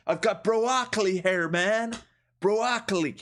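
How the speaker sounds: high goofy bro voice; Chris D'Elia bit. Acted. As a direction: laugh through a silly high boast. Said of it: "I've been watching too much Chris D'Elia".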